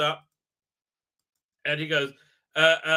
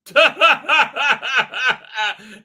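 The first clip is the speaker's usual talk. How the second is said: loud, long run of laughter; real cackle; 3 semitones higher, 8 dB louder than usual. Real, not acted. Reviewer relishing the keeper slide, loud real cackle. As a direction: cackle helplessly in a rapid burst.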